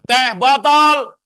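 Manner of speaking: Teh botol called out high, loud and slow; slow drawn-out shout. Shown as a demonstration. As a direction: call "Teh botol!" out to the hall, stretching every syllable. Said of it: "Ya, saya teriak sesuatu".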